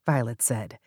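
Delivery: ordinary delivery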